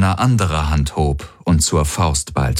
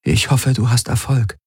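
lightly voiced woman, a touch of worry; worried Ylana; 6 semitones higher than usual. A narrator's reading. Ylana wishing Garland luck, mildly worried. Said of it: "Ylana nickte besorgt".

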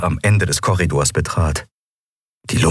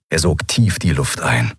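usual speaking voice

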